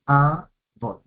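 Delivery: "Abot" as two separate beats, A... bot; split into syllables. Shown as a demonstration. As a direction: pause after the A of "Abot". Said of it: "Dos sílabas".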